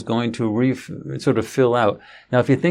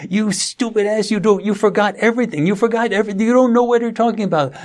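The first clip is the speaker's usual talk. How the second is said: much higher, hammed-up angry berating; imitating furious son; 10 semitones higher than usual. Son berating his father for forgetting, a much higher mock rant. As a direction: play the son ranting, faster by the end. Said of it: "Ooh, he was saying".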